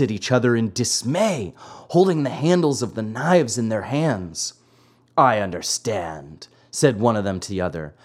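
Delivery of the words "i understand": low assassin voice. A narrator's reading. Assassin speaks to the other.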